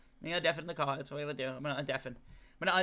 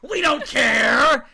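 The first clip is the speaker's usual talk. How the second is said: loud, high, slow mock bellow; imitating angry mob; 7 semitones higher, 9 dB louder, much slower than usual. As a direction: stretch out each shouted demand. Acts out chat rejecting him.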